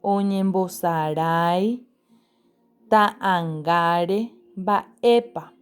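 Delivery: despacio, palabra por palabra, como dictando; léelas despacio para los chicos; lectura lenta. Shown as a demonstration. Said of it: "Vamos a leer las palabras". (lectura pausada de maestra)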